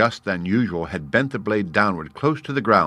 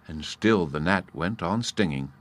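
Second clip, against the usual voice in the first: ordinary delivery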